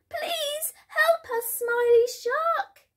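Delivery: very high, slow pleading fish voice; crying out. A narrator's reading. Trapped fish beg Smiley Shark for help, crying out. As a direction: plead in a tiny, squeaky cartoon voice. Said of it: "cried the fish".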